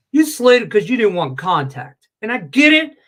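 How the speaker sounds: loud, high ranting at the quarterback; heated rant. Real, not acted. Ranting at Mahomes, loud and high. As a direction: tear into him, voice climbing.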